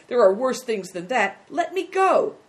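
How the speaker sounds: loud outburst rising to a high demand; crying out. Acted. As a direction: build to a high, loud cry for release. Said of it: "cried the boy".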